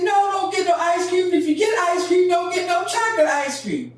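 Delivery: high, loud mother's shout; mama scolding. Retold or imitated. Mama calling out to daddy, high shouted warning. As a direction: cry out to stop the chocolate. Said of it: "She said".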